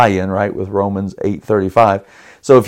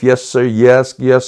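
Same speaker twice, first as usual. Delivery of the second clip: ordinary talk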